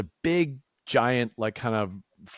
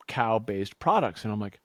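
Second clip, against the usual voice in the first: ordinary talk, nothing marked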